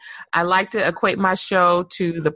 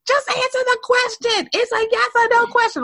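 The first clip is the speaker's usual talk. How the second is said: retold scream. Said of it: "And we're screaming".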